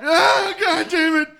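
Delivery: loud cry of pain; pained yell. Acted. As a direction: yell as if struck. Hit by newspaper.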